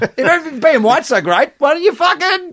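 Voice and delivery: mock high voice. Acted mock quote, laughing.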